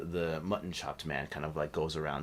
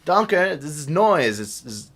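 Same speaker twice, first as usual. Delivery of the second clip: alarmed human voice; 12 semitones higher, 14 dB louder than usual. Acted. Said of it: "And he says".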